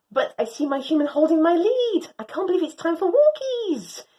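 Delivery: usual speaking voice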